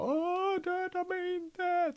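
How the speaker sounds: very high, drawn-out mock wail; hammed-up pleading, stretched out high; writhing plea. Actor demonstrating his character left on the floor, hammed-up writhing plea. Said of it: "bara så här åmar mig".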